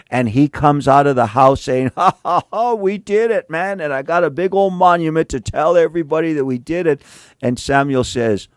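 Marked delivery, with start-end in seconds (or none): 1.9-7.0 high, fast gloating laugh, a cocky king bragging; gloating Saul. Retold or imitated. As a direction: cackle and brag like you got away with it. Preacher acts Saul gloating.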